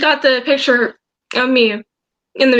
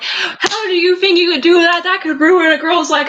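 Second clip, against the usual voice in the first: imitated scolding outburst at raised pitch; retold angry screaming; 4 semitones higher than usual. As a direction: re-enact yelling at him in outrage. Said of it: "I was screaming at him".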